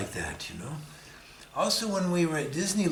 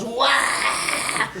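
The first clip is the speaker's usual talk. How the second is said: amazed wow; 9 semitones higher, 4 dB louder than usual. Retold or imitated. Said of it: "and he goes".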